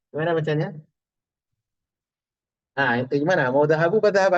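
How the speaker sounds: ordinary talk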